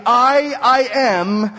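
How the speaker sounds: slow, loud, high stress on "I, I am"; "I, I am" stretched out and pitched high; emphatic. Acted. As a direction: declare "I, I am" forcefully, drawing out each word. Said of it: "he says emphatically".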